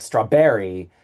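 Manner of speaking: "strawberry" drawn out, stressed on BER; misplaced stress. Shown as a demonstration. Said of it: "put the wrong accent in".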